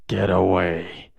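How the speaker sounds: low, slow menace; quietly pissed warning. Acted. Pissed boy warning off a peeping classmate, low menace. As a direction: draw it out low, a contained threat. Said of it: "he's looking at a pissed Deku".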